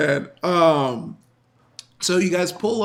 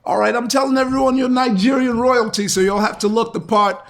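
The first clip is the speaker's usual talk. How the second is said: higher-pitched, instructing put-on voice; brief the group in a raised character voice; guide character voice; 5 semitones higher, 4 dB louder than usual. Limousine driver tells everyone their cover story, in a higher character voice. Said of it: "he's like".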